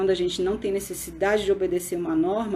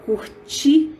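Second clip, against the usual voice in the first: "curtir" said high and loud, with the last syllable "-tir" hit hard; stressed syllable; 7 semitones higher, 7 dB louder than usual. Shown as a demonstration. Teacher shows the strong syllable of "curtir", loud and high. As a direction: punch the final "-tir" of "curtir". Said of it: "que se fala".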